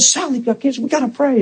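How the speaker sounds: high, hurried alarm on the phone; rally a friend to pray, fast; mock panic calling a friend; urgent alarm. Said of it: "And you call your friend".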